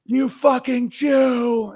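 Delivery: high shouted sneer, slowed; mocking shout at the guy who gives advice; mock angry critic. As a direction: yell it like an outraged troll.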